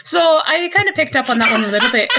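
lilting, storytelling Irish accent. Shown as a demonstration. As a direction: chat away with an Irish lilt. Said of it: "I can slip into that one".

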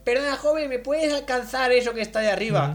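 put-on sweet old lady asking a favour; man doing a woman's voice. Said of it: "intentando hacer voz de señora".

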